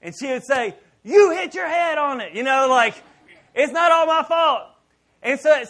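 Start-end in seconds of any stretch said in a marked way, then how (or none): 1.0-2.3 wife's retort. Retold or imitated. Wife retorting in retold quarrel. 3.5-4.6 wife's retort. Retold or imitated. Wife retorting in retold quarrel.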